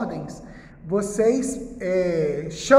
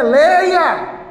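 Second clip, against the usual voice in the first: loud, high teacher's command; ordering, imperative; 6 semitones higher, 8 dB louder than usual. Acted. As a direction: shout it as an order to the class. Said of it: "não é uma ordem?". (ordem gritada de professor)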